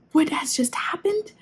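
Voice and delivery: shocked hushed question, rising; whispered disbelief; stunned whisper. Real, not acted. Stunned reaction to chapter.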